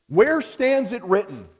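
Where stands it written? called out high and loud; ringing challenge. Retold or imitated. Preacher voicing the free church catchphrase, loud and high. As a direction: fling the question out like a rallying cry. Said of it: "was their phrase".

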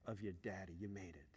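soft, low fatherly reassurance; retold dad, hushed and tender; tender praise. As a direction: let it sink to a quiet, loving close.

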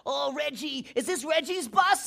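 high, drawn-out silly voice; goofy joke voice. Retold or imitated. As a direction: call for Reggie in a daft falsetto. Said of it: "I just started this joke saying".

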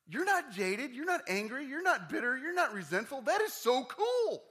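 very high, loud gushing wonder; thrilled, shouted praise; amazed admiration. Retold or imitated. Acted amazed praise of guest.

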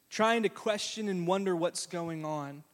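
ordinary talk, nothing marked

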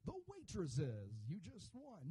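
lightly voiced radio host announcing a winner; mock radio host. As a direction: announce it like a radio prize giveaway. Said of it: "God sounds like some radio host".

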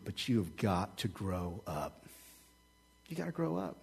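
understated, soft stern father; quiet father's charge. Retold or imitated. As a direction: drop to near a hush for the last words. Dad's final charge to son.

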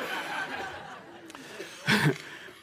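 a soft, breathless laugh at his own joke; breathy laugh. Real, not acted. Pastor laughing at the silent room, an airy laugh. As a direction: let an airy laugh slip out. Laughs after tearing Bible.

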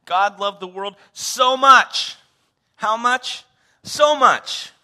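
slow, high, loud proclamation; emphatic preacher's flourish; dramatic declaration. Acted. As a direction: proclaim it big, with a theatrical question and answer. Repeats the dramatic love declaration.

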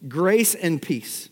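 "Grace and peace" drawn out slowly, each word weighted; slow emphatic. Shown as a demonstration. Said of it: "could you use some of these".

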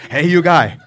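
put-on father calls his sons over in Korean accent; dad calling in Korean accent. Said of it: "He's talking about us".

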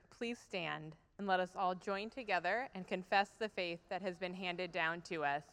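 usual speaking voice

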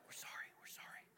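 fast, unvoiced sorry whisper; sheepish whisper. The wind apologizing to Jesus, sheepish whisper. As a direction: whisper it like a scolded kid.

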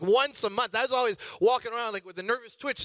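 usual speaking voice

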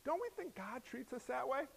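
usual speaking voice

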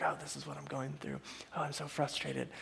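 imitating a frustrated grumble; mock whiny complaint. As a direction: mutter it like someone sorry for themselves. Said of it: "it's like".

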